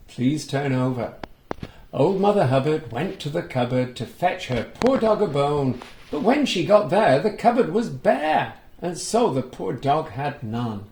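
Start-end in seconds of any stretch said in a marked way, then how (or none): 1.9-10.8 sing-song nursery rhyme reading; rhyme recital. A narrator's reading. Reading the rhyme to children, sing-song.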